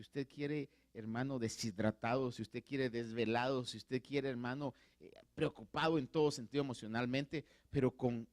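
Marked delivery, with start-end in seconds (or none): none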